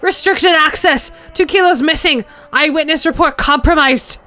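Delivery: high, loud, metallic shouting through a megaphone; metallic megaphone scream. Acted. Crazed woman screaming through her megaphone, high, loud and metallic. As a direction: blare it like a distorted loudspeaker. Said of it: "makes her voice almost painfully metallic".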